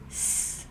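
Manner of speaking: a long unvoiced hiss. Shown as a demonstration. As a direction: hiss the s through closed teeth. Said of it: "To make the".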